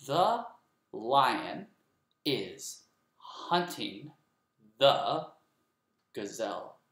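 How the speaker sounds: slow, each word set apart; counting words for the class. Shown as a demonstration. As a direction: pause after every word to count. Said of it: "Let's count that".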